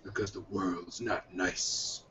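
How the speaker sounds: low, soft and slow, gravely serious; mock tough-guy line; deadpan movie quote. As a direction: drop the voice for a grim reply. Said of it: "the other one is".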